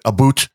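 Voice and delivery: "a boot" for about, with an oo vowel. Retold or imitated. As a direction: mimic Canadian about as "a boot". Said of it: "then you're not Canadian".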